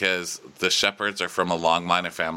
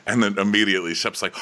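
normal talking voice

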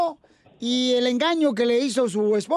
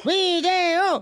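excited shout; 5 semitones higher, slower than usual. Real, not acted. Host teasing caller on the radio, chanting video excitedly. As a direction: egg her on, demanding proof.

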